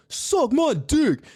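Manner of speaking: high, drawn-out trash-talk jeer; imitated taunt. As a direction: stretch each word into a high-pitched provocation. Quotes the rival rapper's taunt.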